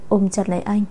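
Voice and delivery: ordinary delivery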